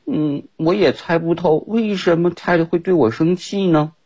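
high, wondering puzzled voice; the kangaroo puzzled why Ted is angry. Acted. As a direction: wonder aloud, baffled. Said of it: "他也非常非常的疑惑".

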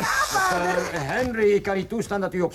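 long high cry of the name, then a rushed protest; alarmed protest. Acted. Doctor objects to the father's cruelty in a high, rushed protest.

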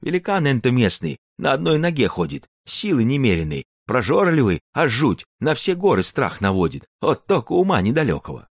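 put-on an old storyteller grandpa warning; old Cossack grandfather. Grandpa describes the giant.

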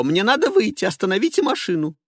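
high, loud urgent plea; panicked demand. Acted. Passenger demanding the driver stop the car, high and loud. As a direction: beg to be let out now.

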